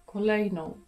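normal talking voice

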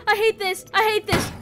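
high-pitched frightened cry; panicked. Real, not acted. Panicking during the chase.

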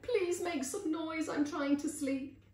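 high, pleading cry. A narrator's reading. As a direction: beg loudly in a little mouse voice. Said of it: "finally she cried out".

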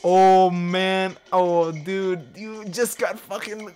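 loud, high, drawn-out cries of amazement; excited outburst. Real, not acted. Player reacting to a sudden hit in the game, excited outburst. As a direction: crow loudly, on the edge of laughing.

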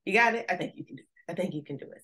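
usual speaking voice

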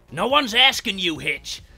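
high, loud, drawn-out cannibal banter; comic-book character voices. Acted. Cannibals bickering over serving Jason as stew, exaggerated character voices. Voicing Cook's and Hitchhiker's quoted dialogue.

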